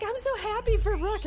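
gushing Kroof impression. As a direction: gush like a proud friend. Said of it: "posts a video and is like".

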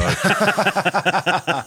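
high burst of laughter; big laugh. Real, not acted. Laughing about the sparring.

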